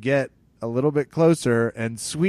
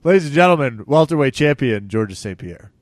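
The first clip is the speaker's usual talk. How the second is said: ring announcer; 2 semitones higher, 7 dB louder than usual. Acted. Host announces the champion like a ring announcer. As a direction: boom it out like a fight announcer.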